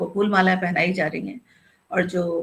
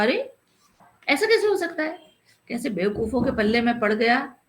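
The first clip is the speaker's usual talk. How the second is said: high-pitched irritated outburst; exasperated; 4 semitones higher than usual. A narrator's reading. Matadeen snapping at the kotwal, exasperated. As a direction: throw up your hands at the fool. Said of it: "उसने झल्लाकर कहा".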